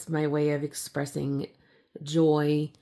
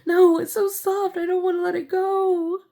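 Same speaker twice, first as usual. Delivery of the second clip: put-on high, squeaky little-kid whine; little child voice; 13 semitones higher, 5 dB louder than usual. Recalling clinging to sweaters as a toddler, high childlike whine. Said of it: "It's almost like".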